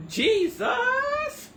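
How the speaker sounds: very high, loud wail of Jesus; Jesus wailed with a sob in it; crying Jesus. Real, not acted. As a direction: cry Jesus out, near tears. Exclaiming in disbelief, half crying.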